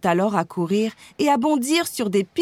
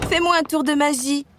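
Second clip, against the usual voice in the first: high, excited begging; eager pleading; 6 semitones higher than usual. A narrator's reading. Anna begging her sister for a magic trick, pleading. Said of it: "la supplia Anna".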